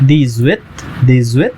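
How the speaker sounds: दी ज्वित with the x sound joined to ज्वित as a z; French liaison. Shown as a demonstration. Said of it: "एक्स का जो sound है वो H के साथ".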